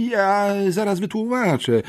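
put-on a boy forcing the words out in panic; choked-out boy's plea. A narrator's reading. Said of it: "wykrztusiłem". (wykrztuszone, wysokim chłopięcym głosem)

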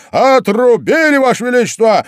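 soldiers' chorus answer. A narrator's reading. Soldiers report to the queen in unison, loud. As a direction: report it loudly like troops in unison. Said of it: "дружно ответили солдаты".

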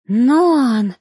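a shocked gasp of his name; mild shocked cry of his name. A narrator's reading. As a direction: gasp it out, hand on heart. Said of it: "ахнула Сария".

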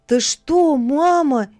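put-on alarmed boy begging his mother; frightened plea. A narrator's reading. Jacob frightened by his mother's rejection, alarmed. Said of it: "испугался Якоб".

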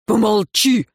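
mildly barked shushing; brothers' curt bark, not fully loud; snapped. A narrator's reading. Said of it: "дружно рявкнули на неё братцы".

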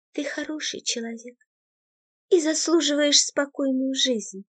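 high, soft, fading goodbye; girl saying a quiet goodbye; sad farewell. A narrator's reading. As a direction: let him go gently. Girl's farewell.

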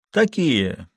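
noncommittal short reply; vague grunt. A narrator's reading. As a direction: toss it out vaguely. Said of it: "неопределённо буркнул он".

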